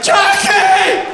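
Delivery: loud, high call for Jackie; yelling for wife. Retold or imitated. Comic calling his wife Jackie, loud high yell. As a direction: yell across the house for her. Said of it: "Yelling to my wife".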